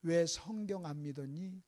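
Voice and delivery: soft, low, slow question; hushed acted reproach; gentle rebuke. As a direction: reproach them quietly, drawing it out. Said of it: "예수님의 책망의 골자는 간단해요".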